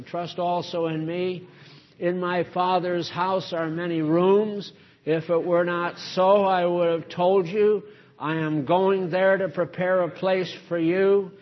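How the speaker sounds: solemn Bible reading; scripture reading. Acted. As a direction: read the gospel to the congregation. Reads scripture aloud.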